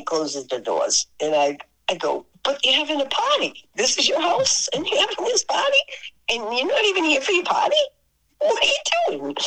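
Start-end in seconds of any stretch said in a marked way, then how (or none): 2.4-9.4 high, rising baffled questions; squeaky, bewildered protest to a host; retold incredulity. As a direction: pile up disbelieving questions, pitch climbing.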